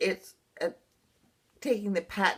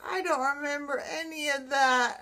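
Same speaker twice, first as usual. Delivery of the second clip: higher-pitched retold distress, without sobs; retold sobbing; 7 semitones higher than usual. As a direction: recount a tearful protest in a raised pitch. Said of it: "I was literally just sobbing".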